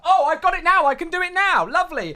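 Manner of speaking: cheery outburst of relief; relieved exclamation. Real, not acted. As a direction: pop back up pleased it worked. Finishes admin task suddenly.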